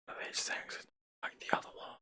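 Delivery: whisper. Acted. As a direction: breathe it out as a secret. Same line, whispered.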